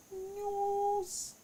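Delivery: high drawn-out jingle on news; mock announcer. Closing the American news segment, with a hammed-up high announcer flourish. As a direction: sing out news like a TV sting.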